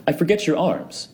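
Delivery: put-on high, careless drunken question; tipsy Fortunato. A narrator's reading. Fortunato quizzing Montresor about his arms, high and tipsy. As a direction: toss it off like a merry drunk.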